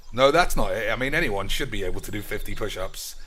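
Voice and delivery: ordinary delivery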